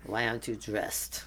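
low, slow, looking down his nose; a hint of a snooty maitre d'. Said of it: "some snooty maitre d' looking down at you going".

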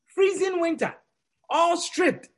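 ordinary delivery

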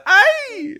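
imitated "Ay!" squeal, very high and loud; mock yelp. Quoting the prankster's funny cry, mock yelp. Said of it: "With the way he said".

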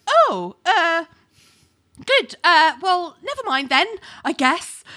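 high, halting mock awkwardness; flustered backpedalling. Acting out jealous Ali backing off, flustered.

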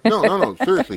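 loud, laughing protest; laughing insistence. Real, not acted. Laughing insistence over beer story.

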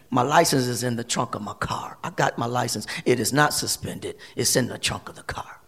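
low, very soft, nearly whispered; subdued retold protest. Handcuffed driver still protesting from squad car, hushed retelling. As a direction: drop right down to a hushed, low murmur.

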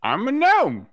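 high squeaky gnome voice; proud and high, tiny gnome. Acted. Gnome character voice.

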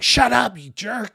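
high, loud, rude shout; angry crowd shout. Retold or imitated. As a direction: bark it at the beggar like the crowd. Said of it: "They're really saying".

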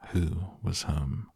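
ordinary delivery, nothing marked

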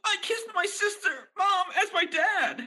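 melodramatic wail. Acted. Dramatic scene ending outburst.

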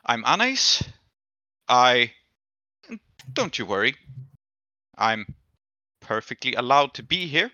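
a hint of threat under sweet words; slightly sinister. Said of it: "with, like, a slightly sinister undertone".

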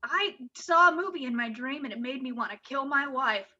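usual speaking voice

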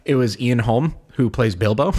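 usual speaking voice

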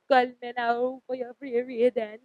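hammy Jamaican hotline psychic, slow and loud; fake Jamaican accent. Tarot reader spoofing a TV psychic's hotline pitch, fake Jamaican accent. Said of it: "work on my fake Jamaican accent".

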